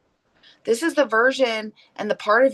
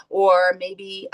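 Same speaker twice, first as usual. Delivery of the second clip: ordinary talk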